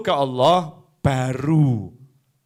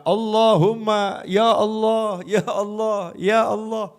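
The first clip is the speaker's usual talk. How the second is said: desperate plea; 5 semitones higher, slower than usual. Acted. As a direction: beg God in panic when cornered. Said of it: "baru terlihat".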